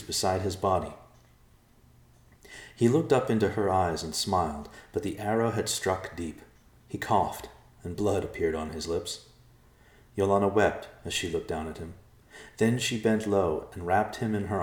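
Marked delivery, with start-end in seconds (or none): none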